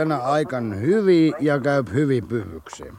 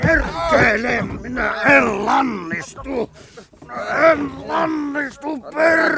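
raging defiance; 10 semitones higher than usual. Acted. Tied-down Koskela raging.

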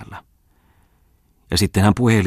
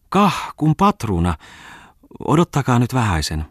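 cheerful greeting; 7 semitones higher, 4 dB louder than usual. A narrator's reading. Farmwife greets the merchant with delighted, high cheer. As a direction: gush with joy at the guest. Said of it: "sanoen iloisesti".

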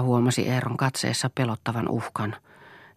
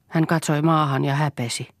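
plain reading voice

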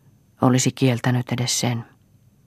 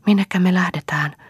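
timid question; 7 semitones higher than usual. Acted. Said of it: "kysyi Marja arasti".

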